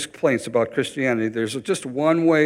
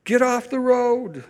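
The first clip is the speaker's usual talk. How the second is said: urgent command; 9 semitones higher, slower than usual. Acted. Parent shouting child off street.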